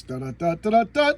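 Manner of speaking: vocal drum hits jumping high and loud; staccato mouth drumming, building sharply; imitated drumbeat. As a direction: beat out drums on "da". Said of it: "it sounds like drums".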